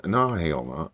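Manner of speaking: usual speaking voice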